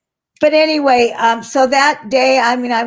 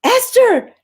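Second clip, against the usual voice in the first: surprised recognition; 12 semitones higher, 4 dB louder, slower than usual. Retold or imitated. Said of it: "she goes".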